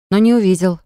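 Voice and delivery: ordinary delivery, nothing marked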